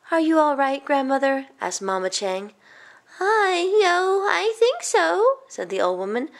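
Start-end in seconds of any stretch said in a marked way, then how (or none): 0.1-1.5 higher-pitched, solicitous question; concerned mother. Acted. Mama checking on the old woman, higher and concerned. 3.2-5.4 put-on high, slow elderly voice; dazed old woman. Old woman answering Mama after collision, high, slow and dazed.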